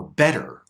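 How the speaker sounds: "better" with the T lightly flapped. Shown as a demonstration. Teacher giving an example of the flap T, "better" lightly tapped. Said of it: "The flap T has very light contact".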